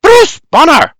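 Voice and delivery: high, loud scolding call; hollering. A narrator's reading. Berta hollers from the porch, scolding. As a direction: holler the name across the yard. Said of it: "Berta hollers from the porch".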